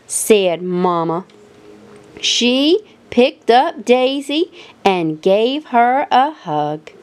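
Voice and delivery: ordinary delivery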